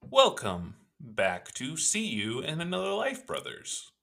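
slow, high robot voice with a rising end. Acted. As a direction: announce like a robot. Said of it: "Now I'm doing the robot voice".

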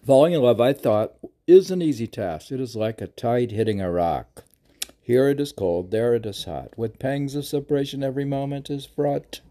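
loud opening, rhythmic lilting phrases; verse recitation. Acted.

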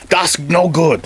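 a hint of a bad German accent, Carl throwing a rock; lightly hammed-up German accent; terrible German accent. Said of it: "That's a terrible German accent".

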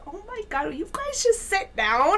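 high-pitched retold exasperation; exasperated plea. Acts out scolding the moms.

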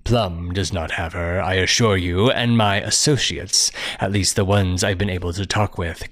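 ordinary delivery

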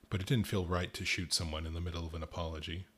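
usual speaking voice

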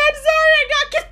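very high, fast squeaky clerk voice; mock dying clerk. Clerk squeaking as she gets shot, fast mock. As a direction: squeak it like a cartoon victim. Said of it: "and, like".

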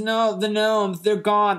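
high-pitched mock-urgent boy; insistent kid. Joe insists to his parents, high urgent protest.

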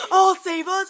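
loud heroic mock vow; heroic echo. Echoing the heroic line.

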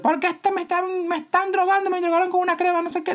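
mock panic at a very high pitch; a woman panicking at the store manager in a mock hysterical squeal.

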